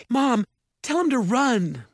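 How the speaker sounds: high, loud urgent shout. A narrator's reading. Mallory calling to her mother, shouting. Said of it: "Mallory shouted".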